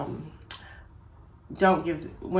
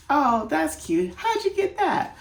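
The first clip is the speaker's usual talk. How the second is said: much higher, louder, slow amused coo; amused, gentle; 11 semitones higher, 6 dB louder than usual. Shown as a demonstration. Mom reacting kindly to a funny misreading, high amused coo. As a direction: coo it fondly, as if charmed. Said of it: "I tend to chuckle a little bit".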